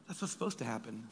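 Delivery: quiet repeat. Shown as a demonstration. Preacher repeating the point to the congregation, quiet and rueful. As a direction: let the repeat fall soft and rueful.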